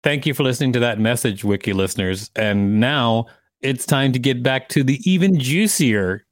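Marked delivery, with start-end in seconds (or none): none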